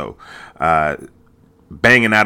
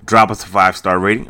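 usual speaking voice

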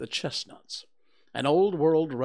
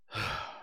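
slow mournful sigh, soft; long sad sigh; 6 dB quieter than usual. Acted. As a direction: let out a heavy donkey sigh. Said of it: "with a long and sad sigh".

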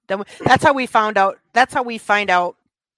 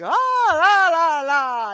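bad acting; 7 semitones higher, 3 dB louder, much slower than usual. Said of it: "it's just so terrible".

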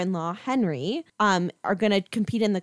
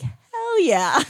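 mock approval; 9 semitones higher, 4 dB louder than usual. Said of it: "If I was a judge".

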